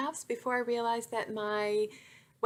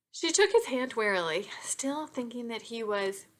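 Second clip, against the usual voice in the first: ordinary delivery, nothing marked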